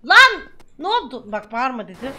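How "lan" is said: higher, louder burst; sudden shout. Real, not acted. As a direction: yell out in shock.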